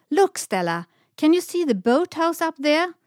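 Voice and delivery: loud high call across the water; shouting. A narrator's reading. Semlan shouts to Stella from her swan, loud call. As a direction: shout out to point something out. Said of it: "shouted Semlan".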